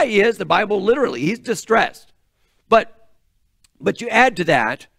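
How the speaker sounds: ordinary talk, nothing marked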